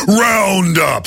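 booming radio announcer shout; monster truck ad. Retold or imitated. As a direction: yell it like an arena ad. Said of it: "Sounds like it's a monster truck advertiser".

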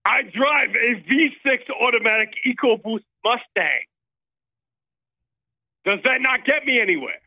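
Sebastian Gorka. Acted. Caller posing as Gorka boasts of his Mustang, fake accent. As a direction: boast in a mock foreign accent. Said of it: "Sebastian Gorka calls into the war room".

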